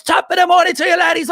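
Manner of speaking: screaming video intro, very high and loud; Jacksepticeye. Retold or imitated. Imitating how he starts every video, high screamed greeting. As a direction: imitate a YouTuber shouting his greeting into the mic. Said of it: "screaming into the microphone at you".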